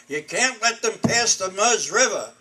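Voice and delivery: a retold officer's loud demand; table-pounding demand. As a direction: hammer the point home like a general at a war table.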